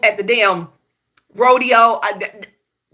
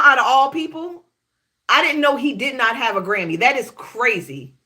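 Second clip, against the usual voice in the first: normal talking voice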